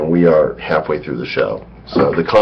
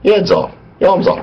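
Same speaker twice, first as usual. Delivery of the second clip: high British knight voice, pitched up; Monty Python knight; 12 semitones higher than usual. Retold or imitated. Quoting Monty Python's knight to co-hosts, high British voice. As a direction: play the silly Python knight. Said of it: "It's Monty Python".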